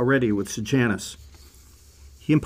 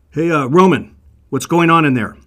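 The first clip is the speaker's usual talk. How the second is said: loud, raised call from outside the door; calling out loudly; 4 semitones higher, 8 dB louder than usual. Acted. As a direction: shout in to the Roman guard, pushy. Leader calls in to guard.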